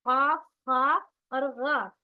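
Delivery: काफ, खा and गा said slowly, loud and full-mouthed; teacher naming the heavy letters, loud and slow. Shown as a demonstration. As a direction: sound out the three thick letters slowly. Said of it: "मोटे और ऊंचे".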